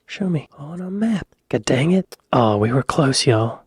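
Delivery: normal talking voice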